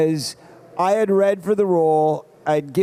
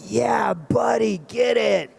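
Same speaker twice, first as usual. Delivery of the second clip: cheering; 3 semitones higher, slower than usual. Retold or imitated. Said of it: "I was sitting there in the theater going".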